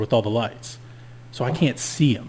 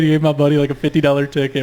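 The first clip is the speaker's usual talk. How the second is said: normal talking voice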